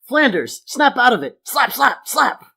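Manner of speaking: loud, high, punched-out slaps; frantic snapping-out. Acted. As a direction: bring a hysterical man round, voicing each slap. Acts Homer slapping hysterical Flanders.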